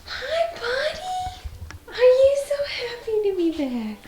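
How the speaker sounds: very slow, high, teary coo to the cat. Real, not acted. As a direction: welcome the sick kitty home, almost crying. Welcoming sick cat home.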